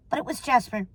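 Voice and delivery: usual speaking voice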